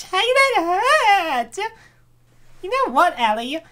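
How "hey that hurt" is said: louder, very slow whine of pain; hurt protest. Acted. Emily protesting after the slap, slow and loud. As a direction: drag it out like a wounded yelp. Character slapped on bottom.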